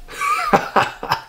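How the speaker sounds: high-pitched giggly cackle; laughing. Real, not acted. Fan delighted by Tyler's wordplay, laughing high.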